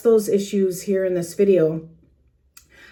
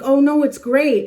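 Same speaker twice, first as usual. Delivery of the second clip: eager reassurance; 6 semitones higher, 4 dB louder than usual. Retold or imitated. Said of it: "and you're like".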